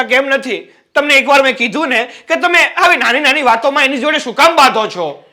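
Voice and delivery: rising into a shout at her. Acted. Brother-in-law raising his voice at his sister-in-law, exasperated. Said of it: "તમે શું કામ ઊંચા અવાજે બોલો છો".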